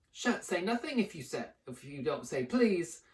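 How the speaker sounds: teasing lilt; annoying sing-song. Acted. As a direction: taunt Filch playfully. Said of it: "annoying sing-song voice".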